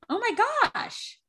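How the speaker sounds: high, drawn-out surprise; surprised. Real, not acted. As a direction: react with slow amazement. Reacting to the Invisalign news.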